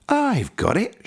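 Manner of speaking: a flash of inspiration; excited cry. A narrator's reading. The snail finding her idea, a high excited cry. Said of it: "she cried".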